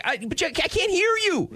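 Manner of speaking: very high, hammed-up complaint; acted exasperated outburst; frustrated protest. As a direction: plead in exasperation, voice way up. Husband's frustrated retort to wife.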